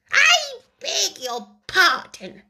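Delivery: high, slow, stiffly polite; icy politeness through a frown; frowning but polite. A narrator's reading. The offended mouse speaks to the Lory, stiffly polite. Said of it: "frowning, but very politely".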